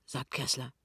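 ordinary delivery, nothing marked

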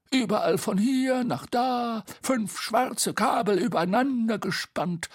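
high, leisurely character voice; Bo, dreamy delight. Acted. Bo describing the view to Tete, high and delighted. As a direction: savour each sight in a high voice.

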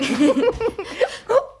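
loud, high burst of laughter; hearty laugh. Acted. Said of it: "Welch himmlische Heiterkeit".

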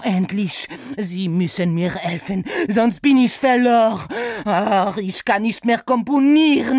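höher, lauter, mit französischem Akzent klagend; verzweifelter Franzose. Acted. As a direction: gehetzt um Hilfe flehen, französischer Akzent. Saint-Saëns, desperate composer. (verzweifelt, französischer Akzent)

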